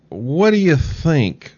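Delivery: ordinary talk, nothing marked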